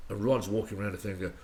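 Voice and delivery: usual speaking voice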